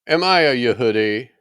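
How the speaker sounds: a governor losing patience with the prisoner; exasperated Pilate. A narrator's reading. Pilate presses Yahushua, exasperated and demanding. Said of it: "Pilate answered".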